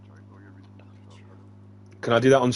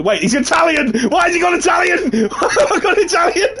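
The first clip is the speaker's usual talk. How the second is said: high, loud incredulous laughing shout; laughing disbelief; 5 dB louder than usual. Real, not acted. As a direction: crack up yelling at your own blunder. Cracks up at own failed accent.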